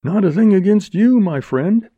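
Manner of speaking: high, loud, placating denial; hasty reassurance. Acted. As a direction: rush to deny any grudge, over-friendly. Man hastily placates the bandit.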